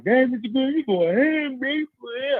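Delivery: put-on high teasing kid voice; imitating kids. Students teasing teacher Mister Green in the hallway, high kid voice. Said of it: "All the kids was like".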